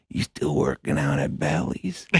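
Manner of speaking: low, slow retold mumble; slow, low-pitched imitation; imitated star's line. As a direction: lightly impersonate him, low and drawn out. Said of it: "he locks eyes with me. He goes".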